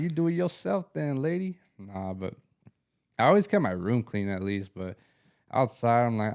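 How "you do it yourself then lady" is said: mock sass. Talking back to mom.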